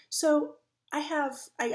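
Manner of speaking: normal talking voice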